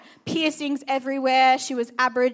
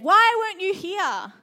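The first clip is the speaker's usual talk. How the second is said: high, louder anguished cry of reproach; grieving reproach; 8 semitones higher, 6 dB louder than usual. Retold or imitated. Lazarus' sister to Jesus, anguished raised reproach. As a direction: voice the sister's hurt accusation.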